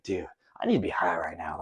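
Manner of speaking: unvoiced aside to himself; retold thought, whispered. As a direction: breathe it under his breath.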